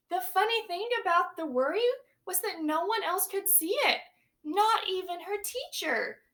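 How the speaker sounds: put-on lively read-aloud for young children; storybook reading. A narrator's reading. Teacher reading a picture book to her class, lively storytelling. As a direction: read it big and bright to the class.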